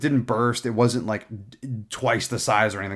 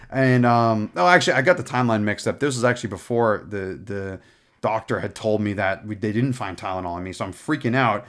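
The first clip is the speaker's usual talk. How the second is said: usual speaking voice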